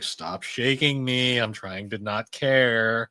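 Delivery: slow, flat-toned deadpan drawl. Retold or imitated. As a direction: drag it out, pretending not to care. Gina annoyed at her friend.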